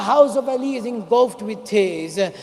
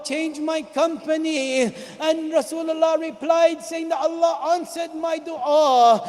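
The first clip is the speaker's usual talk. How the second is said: raised lament over Imam Ali's death; lamenting sermon; 5 semitones higher than usual. Acted. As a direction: cry the grief out to the mourners. Imam's dream quoted in lament.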